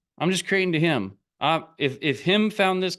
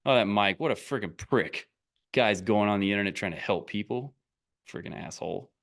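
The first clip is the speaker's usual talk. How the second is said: mocking a hater; 7 semitones lower, 3 dB quieter than usual. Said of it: "what are you gonna really say?".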